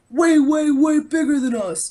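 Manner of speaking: lightly voiced Trump boasting; Trump impression. Mimicking Trump to explain size to a kid, light impression. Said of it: "Talk like Trump".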